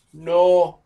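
"November" as no ... vem ... ber, long gaps; syllable by syllable. Shown as a demonstration. Teacher breaks the month down for students, widely spaced syllables.

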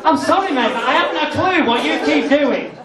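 ordinary talk, nothing marked

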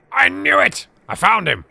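loud, high triumphant outburst; excited discovery. Real, not acted. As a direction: burst out, thrilled at the find. Finds the monster being searched for.

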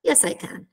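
"Yes, I can." low and soft; umbrella intonation answer. Shown as a demonstration. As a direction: let "Yes, I can." fall away as the answer. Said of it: "fa formare sempre la mia forma dell'ombrello".

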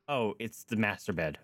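ordinary talk

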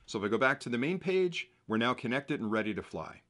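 low, soft, even instructional narration; tutorial voiceover. Acted. Narrator walks viewers through pairing the drone, calm voiceover.

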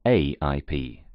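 normal talking voice

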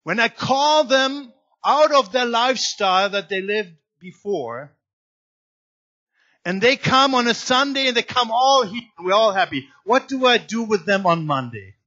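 loud, driving preaching to the congregation; impassioned sermon delivery; forceful preaching. Acted. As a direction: press each question hard from the pulpit.

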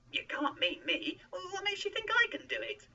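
high, loud, disbelieving protest; incredulous exclaiming. A narrator's reading. Moses doubts he is the one God means, incredulous. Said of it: "Moses exclaimed".

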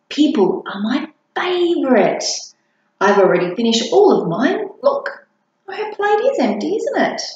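a sister showing off her empty plate; boastful big sister. Acted.

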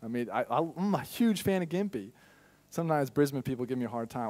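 ordinary delivery

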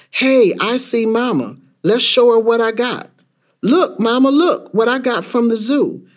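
put-on high-pitched, eager little kid; excited child. Child calling Mama to see what he got, excited. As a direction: call out to show off a prize.

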